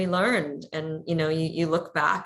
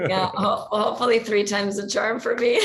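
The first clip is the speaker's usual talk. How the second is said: laughing; 4 semitones higher, 4 dB louder than usual. Real, not acted. Laughing about third marriage.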